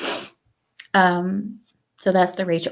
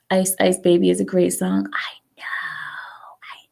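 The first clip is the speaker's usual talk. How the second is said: hushed unvoiced whisper. Real, not acted. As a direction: whisper to the chat. Whispering to chat.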